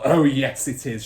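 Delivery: loud contradicting shout. Acted. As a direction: shout her down. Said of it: "shouted Miss Trunchbull".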